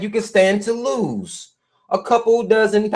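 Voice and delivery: ordinary talk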